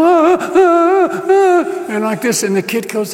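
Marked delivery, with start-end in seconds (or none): 0.0-1.9 high-pitched drawn-out "ah" cries; mock freak-out wail. Preacher imitates parents seeing their son stand, mock freak-out. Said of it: "And they're freaking out, going".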